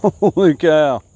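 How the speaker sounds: loud, slow laughing holy cow; laughing amazement. Real, not acted. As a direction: laugh in disbelief. Surprised at the catch.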